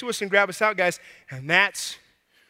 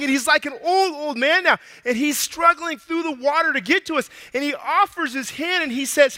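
ordinary talk, nothing marked

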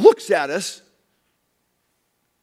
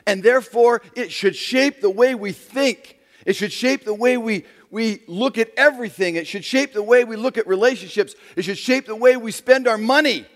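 loud, high, building sermon cadence; preaching at full force; 8 semitones higher, 7 dB louder than usual. Acted. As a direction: preach it out to the whole room, rising.

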